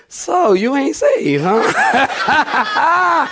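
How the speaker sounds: high, slow laughing jab; teasing. Retold or imitated. Husband still needles his wife years later, teasing. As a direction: stretch the tease out, then crack up.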